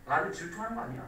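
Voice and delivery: fast, soft rushed excuse; fast self-justifying mutter, lightly acted; quick mock excuse. Shown as a demonstration. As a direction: rattle off the excuse quickly to cover it up. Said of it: "그냥 이렇게".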